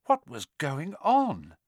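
plain reading voice

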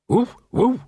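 high, loud put-on dog voice; dog's woof. A narrator's reading. A stray dog mocks the watchmen with a high, loud woof. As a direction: bark the words like a scruffy mutt.

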